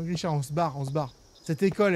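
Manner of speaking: ordinary talk, nothing marked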